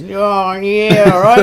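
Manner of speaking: high, loud mock ocker bartender. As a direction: ham up a laid-back barman. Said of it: "the bartender goes".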